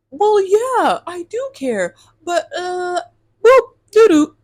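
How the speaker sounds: high, loud silly mock voice; mocking his stammer. Imitating the date's lame excuse, high mock babble. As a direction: parody his lame dodge, ending in nonsense noises. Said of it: "And he's like".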